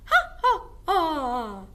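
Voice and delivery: high, loud cackling witch laugh; witch cackle. Shown as a demonstration. Teacher shows the class a witch's cackle. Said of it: "witches laugh".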